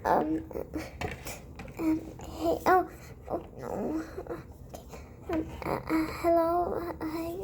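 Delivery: hushed and hesitant; timid quiet greeting; soft nervous hello. Acted. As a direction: creep in shyly, voice small. Nervous approach to counter.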